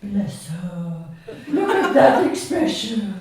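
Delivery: put-on fond cooing over the girl; lightly voiced official. The official coos over Susan after the ride, lightly voiced. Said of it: "says the official".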